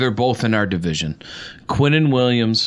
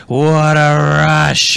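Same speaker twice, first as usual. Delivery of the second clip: slow, drawn-out, louder pro-wrestler bellow; wrestler catchphrase; 3 semitones higher, 6 dB louder, much slower than usual. Retold or imitated. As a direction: stretch it out like a wrestling promo. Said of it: "It's like the wrestlers".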